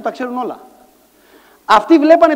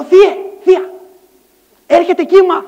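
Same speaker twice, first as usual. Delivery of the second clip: high, loud acted alarm; urgent warning; 6 semitones higher, 7 dB louder than usual. Nephew warning aunt and uncle, urgent. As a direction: call out to relatives with urgency.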